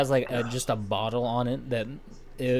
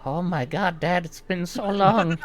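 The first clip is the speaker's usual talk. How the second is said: mock tearful reunion; 6 semitones higher than usual. Gnome greeting supposed father.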